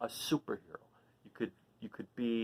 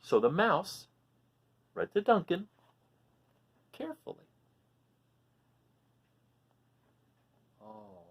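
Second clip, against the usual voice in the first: lively, high storybook voice; animated read-aloud for little listeners; reading to children; 8 semitones higher, 5 dB louder than usual. A narrator's reading. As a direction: read it out like a picture book for kids. Reading picture book to children.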